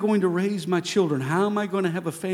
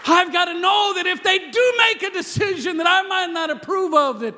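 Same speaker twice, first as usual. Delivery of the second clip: much higher, shouted; preaching shout; 13 semitones higher, 4 dB louder than usual. Acted. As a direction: proclaim it to the back row. Preacher shouts his conviction.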